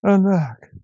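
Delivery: high, loud sighing alack; weary cry. A narrator's reading. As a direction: cry out like a tired old man. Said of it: "said the tailor".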